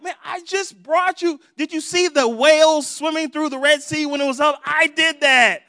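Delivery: loud, high, indignant reminder; exasperated God. Acted. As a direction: play God exasperated at forgetful people. God exasperated at forgetful Israelites.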